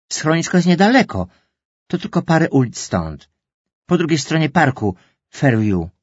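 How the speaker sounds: wysoko i głośniej, gorliwie; zapał. Acted. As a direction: przekonuj mamę, że to blisko. Daughter eagerly pleads. (córka gorliwie przekonuje mamę, wyraźnie wyżej)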